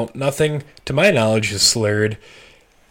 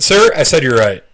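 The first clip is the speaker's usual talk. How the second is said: stern cop; 4 semitones higher, 10 dB louder than usual. Retold or imitated. Cop correcting him.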